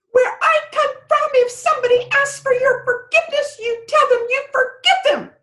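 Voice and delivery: fly into a squeaky little rage; fairy temper tantrum. Acted. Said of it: "fairies can have quite a temper".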